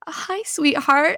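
gently imitating a husband's greeting; tender greeting. Husband greeting his wife after surgery, tender and slow. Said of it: "he's like".

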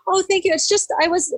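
high-pitched, flustered re-enacted thank-you. Crying hiker to a passing lady, flustered thanks. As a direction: act out thanking someone, pitched well up.

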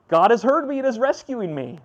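joyful rescued shout; joyful relief. Retold or imitated. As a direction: lift it like sudden rescue. Jonah's joyful refrain.

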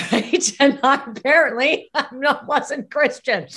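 amused disbelief breaking into laughs; laughing. Real, not acted. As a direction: laugh your way through it. Laughs telling own family surprise.

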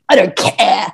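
mock exasperated outburst; fed-up. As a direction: act out being sick of it. Acted fed-up blogger.

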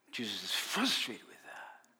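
hushed whisper; whispered aside to the congregation. Real, not acted. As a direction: breathe it out, no voice.

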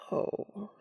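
soft unvoiced oh; breathed oh. A narrator's reading. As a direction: let it out on a breath. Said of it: "Castiel breathes".

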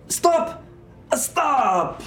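very high, loud cries to stop; high-pitched acted scream. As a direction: shriek it as a panicking character. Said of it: "he's screaming".

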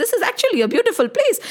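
high, fast, laughing with delight. Acted. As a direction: laugh it out in wonder. Ganga delighted by earth.

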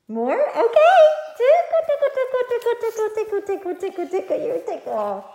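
high squealy tickle chant, fading down; sing-song tickling, loud then settling; giggly tickle attack. Real, not acted. Mother tickling her child playfully.

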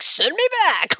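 high, pleading shout; high desperate plea; desperate cry. Retold or imitated. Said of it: "he's like".

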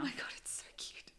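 hushed whisper so the puppy won't hear. Real, not acted. Whispering about the crying puppy next door.

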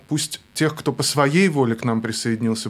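normal talking voice

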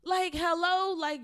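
high, loud, exasperated protest; fed up. Retold or imitated. Woman retelling her impatience with her date, fed up. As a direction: call him out, fed up with waiting. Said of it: "it gets to the point where I get fed up".

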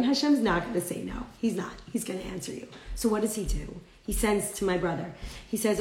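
normal talking voice

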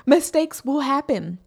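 usual speaking voice

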